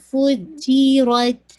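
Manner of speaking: slow فو جی رد; careful recitation. Shown as a demonstration. Teacher modelling careful pronunciation. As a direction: say فو جی رد deliberately, no puff of air. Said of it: "careful لینا".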